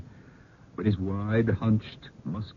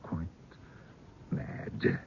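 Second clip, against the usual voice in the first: soft, grave, dropping almost to a whisper; hushed telling; 3 semitones lower, 11 dB quieter than usual. Acted. Uncle telling guests of the madness, hushed. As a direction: lower your voice for the dark part. Uncle tells grim family tale.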